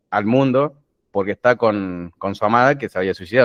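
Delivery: ordinary talk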